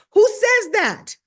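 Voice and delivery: high, loud, drawn-out disbelief; incredulous outburst. Real, not acted. Comedian mocking her own wording, loud incredulity. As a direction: shout it in mock disbelief at yourself.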